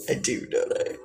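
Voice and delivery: breathy whispered flirt; a teasing whisper to the chat; whispered tease. Acted. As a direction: breathe it like a secret come-on.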